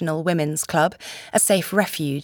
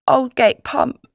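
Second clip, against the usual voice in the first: slow, higher-pitched little lad's answer; miserable boy; 6 semitones higher, 4 dB louder, slower than usual. A narrator's reading. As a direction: give the place slowly, as a wretched boy. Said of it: "The miserable boy mumbled something".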